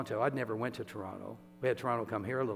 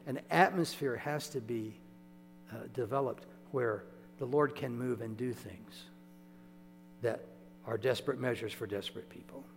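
normal talking voice

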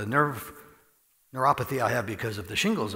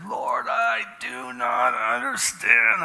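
high, loud and slow, fist raised; desperate cry; 11 semitones higher, 6 dB louder, much slower than usual. Retold or imitated. Alone in the sanctuary, crying out desperately to the Lord. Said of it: "I was like that ... fist in the air".

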